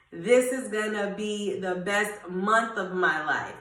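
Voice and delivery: normal talking voice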